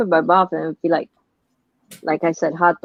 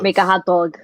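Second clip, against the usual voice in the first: New York accent. Real, not acted. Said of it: "most New York way I could ever hear the word hot dog".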